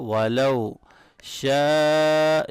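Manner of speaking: slow chant on شَاءَ; long madd. Shown as a demonstration. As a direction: hold شَاءَ a long time. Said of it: "یہاں بڑی مد ہے".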